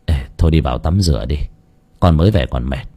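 low, mild weariness; lightly voiced father putting off bad news, low; downcast father. A narrator's reading. Sad father puts off bad news. (giọng tía trầm buồn, lảng chuyện)

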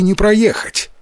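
ordinary delivery, nothing marked

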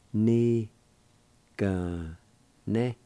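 ni, ga, ne separated with pauses; syllable by syllable. Shown as a demonstration. Teacher sounding out the second part. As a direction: sound out ni ga ne slowly. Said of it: "Second part".

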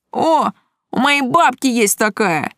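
sudden exclamation. A narrator's reading. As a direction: blurt it out in goofy recognition. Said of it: "внезапно воскликнул один из парней".

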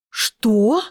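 high, shocked gasp; gasped what. A narrator's reading. Stepmother gasping at the threat of soldiers. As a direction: gasp it out in disbelief. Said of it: "ахнула Дорена".

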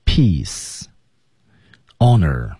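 very slow "honor" with the H unsounded; teacher modeling a silent h. Shown as a demonstration. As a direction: stretch out "peace" and "honor" for learners. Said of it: "H不发音".

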